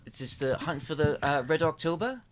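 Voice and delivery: hesitant, bungled Russian accent; a Russian accent that comes out British. Shown as a demonstration. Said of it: "a Russian accent".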